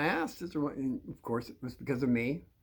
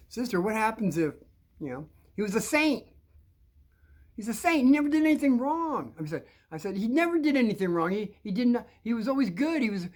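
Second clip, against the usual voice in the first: insistent; 7 semitones higher than usual. Retold or imitated. Child pressing the nun.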